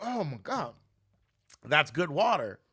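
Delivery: high, loud fake delight; mock praise. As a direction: overact loving the water. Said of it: "I was like".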